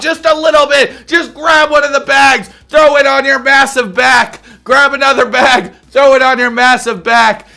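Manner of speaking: loud, high ranting yell; mock rant. Real, not acted. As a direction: shout at the TV, fed up. Ranting at wife on screen.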